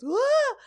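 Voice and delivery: a sudden high yelp of fright, imitated; mock scream. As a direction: shriek like the guy knocked down by the moose. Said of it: "The guy goes".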